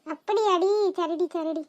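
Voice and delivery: ordinary delivery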